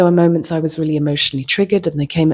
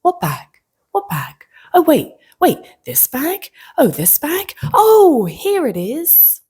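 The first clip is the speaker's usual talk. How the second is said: frantic mock panic; 10 semitones higher, 3 dB louder than usual. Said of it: "He goes".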